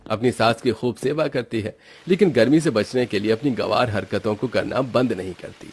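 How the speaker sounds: ordinary delivery, nothing marked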